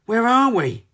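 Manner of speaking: high bewildered question; dazed cry. A narrator's reading. Ginger blinking in the dark silo, dazed and high. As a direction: ask as if just waking. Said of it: "cried Ginger".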